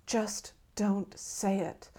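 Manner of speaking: slow, tense warning, each word set apart; fierce, under the breath. A narrator's reading. Forrest staring at dying starfish, fiercely under his breath. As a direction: plead fiercely, word by word, held in. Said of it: "Forrest mumbles fiercely under his breath".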